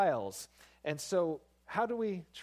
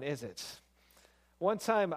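usual speaking voice

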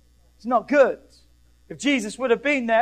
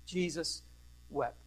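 soft, low, with a long pause between words; solemn hush; 3 semitones lower, 11 dB quieter, slower than usual. Real, not acted. Preacher quoting the shortest Bible verse, solemn hush. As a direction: let each word fall quietly.

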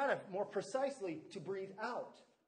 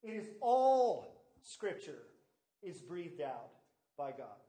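all said louder, slowed; stress on ALL; 4 semitones lower, 4 dB louder than usual. Shown as a demonstration. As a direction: punch the word all. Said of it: "Third point".

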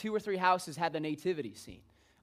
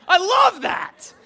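loud, high, drawn-out cry of delight; delighted shout; 13 semitones higher, 13 dB louder, much slower than usual. Real, not acted. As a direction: belt it out, relishing every word. Delight at absurd museum exhibit.

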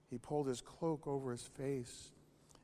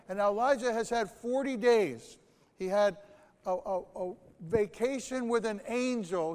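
preaching at full force; 4 semitones higher, 9 dB louder than usual. Acted.

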